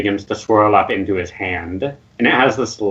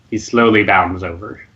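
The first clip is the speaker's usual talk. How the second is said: ordinary talk, nothing marked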